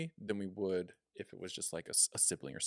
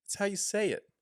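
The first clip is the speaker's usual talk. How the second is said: high-pitched, louder, lilting delivery; raised, airy pitch on "how you say it"; different tone; 9 semitones higher, 6 dB louder than usual. Shown as a demonstration. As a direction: lift the whole line up high, falling on "say it". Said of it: "Those all said something a little differently".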